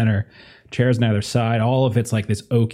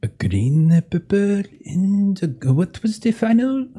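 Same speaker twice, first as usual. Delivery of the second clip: put-on French accent; 9 semitones higher than usual. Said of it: "apparently a terrible French accent".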